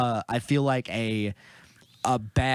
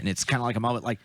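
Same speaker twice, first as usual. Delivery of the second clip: ordinary delivery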